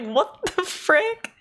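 high, slow and drawn-out, mock shocked; stretched-out incredulous gasp of a question; stunned disbelief. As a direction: act floored by what you just read. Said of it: "I just found this and I was like".

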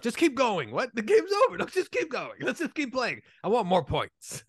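high, whiny greedy coach voice; whiny winning coach. Retold or imitated. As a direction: beg the ref to keep playing.

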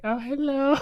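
very high, thin mock girly hello. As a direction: pitch way up into a cartoonish girly voice.

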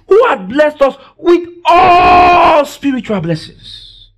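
high, slow preaching cry; fervent proclamation. Real, not acted. Preacher reading out the blessing verse to church, fervent high cry. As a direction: proclaim it slowly, stretching ALL.

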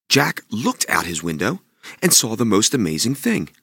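usual speaking voice

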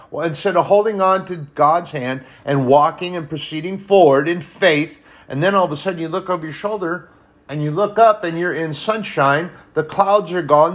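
high, loud impassioned preaching; rising revival preacher's cry; preaching at full force. Real, not acted. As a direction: build the fire of the sermon.

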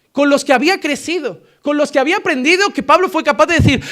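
predicación sostenida y enfática; predicación intensa. Acted. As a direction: insiste con fervor. Predica con fuerza. (predicador enfático ante la iglesia)

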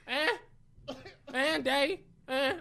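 put-on high-pitched little baby calls; imitated toddler. Dad imitates his son wanting him, high baby voice. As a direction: mimic a fussy toddler's short cries.

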